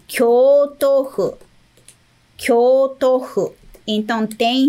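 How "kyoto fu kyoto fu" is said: slow model word. Shown as a demonstration. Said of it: "Fu tem dois".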